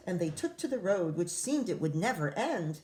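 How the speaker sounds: ordinary delivery, nothing marked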